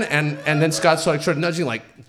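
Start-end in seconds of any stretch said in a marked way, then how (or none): none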